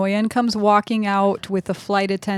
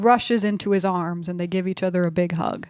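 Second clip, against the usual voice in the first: ordinary talk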